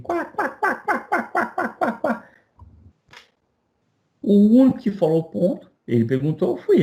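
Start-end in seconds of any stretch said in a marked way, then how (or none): 0.0-2.3 high mocking quá quá cackle, much higher; a room of high mocking quá quá laughs; class cackling. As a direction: mimic the whole class laughing at him. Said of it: "A classe rachando o bico".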